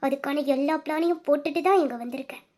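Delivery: ordinary delivery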